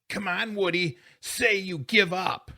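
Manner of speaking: put-on high, urgent boy's demand; demanding surrender. Boy sitting on his enemy, urgently demanding.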